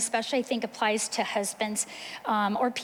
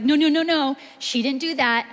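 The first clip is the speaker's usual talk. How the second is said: interrupting sibling; 3 semitones higher, 6 dB louder than usual. Retold or imitated. Sibling interrupting during conflict.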